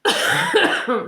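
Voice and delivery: harsh real coughing; hacking cough; coughing fit. Real, not acted. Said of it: "Je suis désolée si je tousse".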